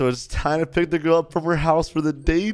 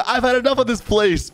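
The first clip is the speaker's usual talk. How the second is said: high-pitched mock exasperation; hammed-up indignant customer; fed up; 10 semitones higher than usual. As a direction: complain in a raised, put-on huff. Acted fed-up customer complains.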